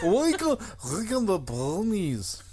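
high, slow mock Scottish; Scottish accent. Egged on to keep doing it, drawn-out silly Scottish accent. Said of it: "you keep doing that".

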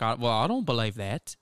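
imitated housewife with a put-on Australian accent; improved Australian accent. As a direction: deliver the retort in a practised Australian accent. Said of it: "That was pretty good".

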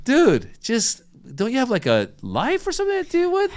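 high-pitched comic disbelief; incredulous, voice shooting way up; exasperated retort. Retold or imitated. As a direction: plead with an absurd ranter. Mock reply to the upset commenter.

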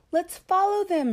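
high, loud eager call; eager cry. A narrator's reading. Little fox to mommy, calling out eagerly. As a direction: call out, thrilled to chase the clouds. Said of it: "he cried".

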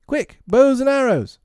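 put-on loud, high boyish cry; high-pitched, urgent shout; urgent call. A narrator's reading. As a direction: call the gang to arms in a child's voice. Boys urgently calling for weapons.

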